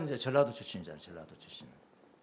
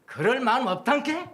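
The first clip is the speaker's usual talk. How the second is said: Jeolla dialect, higher and louder, a firm refusal; 9 semitones higher, 9 dB louder than usual. Shown as a demonstration. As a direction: say it like a stubborn Jeolla local. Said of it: "전라도에서 그 표현할 때는 뭐라면".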